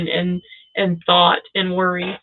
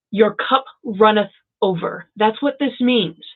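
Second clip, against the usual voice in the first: normal talking voice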